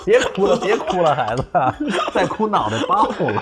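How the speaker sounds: high, loud, laughing mock panic; panicked pleading. Father retelling soothing crying baby, mock panic. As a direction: beg the baby to stop, half laughing. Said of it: "我都吓坏了".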